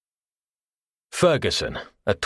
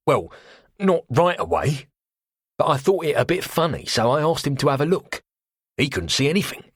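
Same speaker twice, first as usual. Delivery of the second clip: put-on cockney accent; 7 semitones higher than usual. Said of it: "He hesitated".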